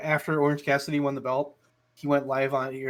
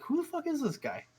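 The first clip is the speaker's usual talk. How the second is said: much higher, baffled question; high mock bafflement; 13 semitones higher, 7 dB quieter than usual. A clueless viewer watching Cassidy walk out, high baffled question. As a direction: ask it high in disbelief. Fan reaction imitated again.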